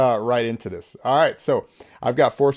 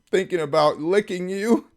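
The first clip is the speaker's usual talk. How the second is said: higher, lightly mocking creep voice; creepy texter; 7 semitones higher than usual. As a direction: imitate a lewd suitor, pitch lifted. Reading out creepy man's lewd text.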